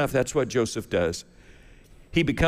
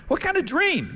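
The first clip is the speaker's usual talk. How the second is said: curious butler. Acted. Butler asks about dream.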